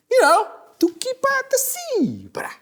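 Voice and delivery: put-on very high, odd kid voice; new kid voice. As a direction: sly kid with a cover story. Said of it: "the new kid says".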